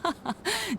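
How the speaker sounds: soft, high little granny chuckle; light chuckle. Real, not acted. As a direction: chuckle gently at the offer. Granny amused by offer.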